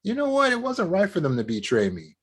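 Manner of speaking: a hint of standing up for yourself; lightly acted indignation; mock indignant. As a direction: pitch jumps high on you know what. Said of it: "get a little mad and be like".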